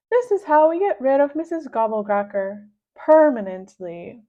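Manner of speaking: gleeful schemer; Mister Nuggy's voice. Acted.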